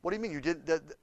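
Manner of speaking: ordinary talk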